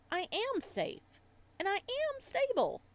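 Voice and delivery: defensive insistence building up; protesting too much; insistent. Acted. Insists on own stability.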